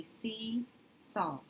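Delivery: seesaw read slowly as two parts, "see" and "saw"; slow sounding out. Shown as a demonstration. Teacher sounding out the word for students, slowly. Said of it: "So this would make this".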